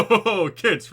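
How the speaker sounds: big booming ho ho laugh; hearty dad laugh. Acted.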